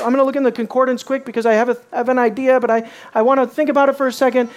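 fast, rushed mock dithering; fast, flustered stalling; hurried fumbling. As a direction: rattle it off like someone stalling. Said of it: "He doesn't say".

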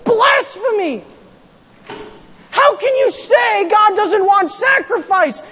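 a shocked crowd yelling at Hosea; outraged scream. Acted. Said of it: "They would have screamed".

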